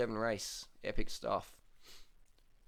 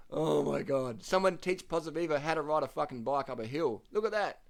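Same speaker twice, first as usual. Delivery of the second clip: exasperated; 7 semitones higher, 6 dB louder than usual. Real, not acted. Continued exasperated rant.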